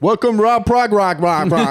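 high, garbled announcer voice; hammed-up MC mangling a name; botched host announcement. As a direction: announce it high, tripping into nonsense. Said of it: "they're like".

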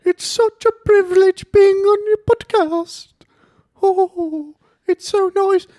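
put-on a posh old queen, cackling and crude; Queen Elizabeth impression. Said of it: "give me Queen of England".

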